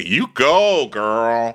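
playful stretched-out cheer; mock cheer. Podcaster cheering on a retold dater, drawn-out mock encouragement.

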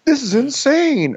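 slow, high-pitched disbelief; imitating an exasperated man; mock outraged outburst. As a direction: wail it in hammed-up exasperation. Retold outburst by Shep.

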